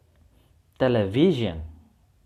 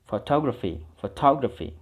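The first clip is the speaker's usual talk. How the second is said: Photography with to stressed. Shown as a demonstration. Teacher modelling Photography for class, stress on to. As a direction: lean on to in Photography. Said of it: "di to-nya".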